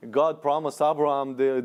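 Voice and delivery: normal talking voice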